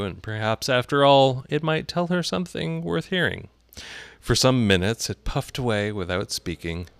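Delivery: ordinary delivery, nothing marked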